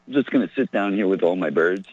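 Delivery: ordinary talk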